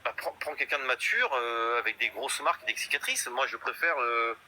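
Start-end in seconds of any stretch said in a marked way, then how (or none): none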